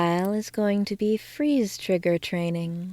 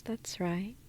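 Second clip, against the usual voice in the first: soft whispered approval; 12 dB quieter than usual. Acted. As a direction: breathe it out as a whisper. Whispered reassurance in hypnosis.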